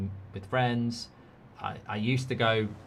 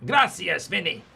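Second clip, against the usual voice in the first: raised, loud mock Spanish accent; 7 semitones higher, 6 dB louder than usual. Streamer thanks Vinny in a mock Spanish accent. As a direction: put on a higher Spanish accent. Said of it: "do my shout-out in a Spanish accent".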